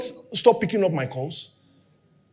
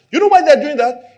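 ordinary talk

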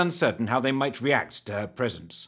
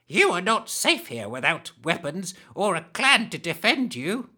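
high hag voice; 7 semitones higher than usual. Acted. Hag warns the stranger.

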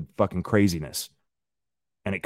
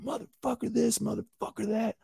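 imitated phone rant, high and softer; mock screaming; 8 dB quieter than usual. Imitating a guy at the laundromat on his phone, mock screaming. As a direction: mimic a distant screamer at reduced volume. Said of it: "this guy is screaming into his phone".

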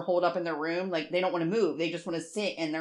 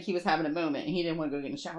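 ordinary talk

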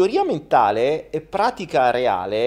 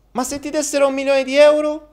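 put-on childish boy voice; 6 dB louder than usual. Boy asks sister.

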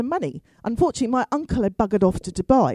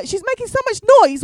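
ordinary talk

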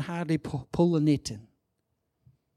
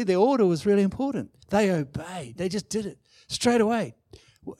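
ordinary delivery

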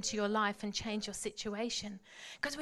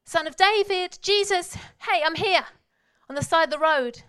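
1 loud calling out; 5 semitones higher, 9 dB louder than usual. Retold or imitated. Bartimaeus calling out persistently.

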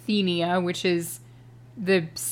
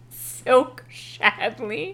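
laughing; 9 semitones higher, much slower than usual. Real, not acted. Laughing at silly guessed name.

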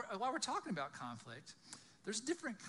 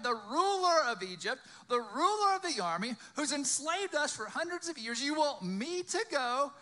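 loud, high-pitched disbelief; incredulous questioning; 9 semitones higher, 10 dB louder than usual. Acted. Moses balking at facing Egypt's ruler, loud and incredulous. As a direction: pile up objections, each more disbelieving.

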